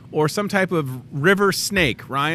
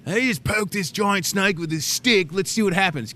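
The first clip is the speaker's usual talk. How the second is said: mock snake provoker; 5 semitones higher than usual. Mocking people who provoke snakes.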